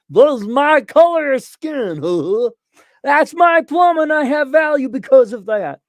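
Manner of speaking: hammed-up Southern yokel voice. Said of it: "a Southern yokel".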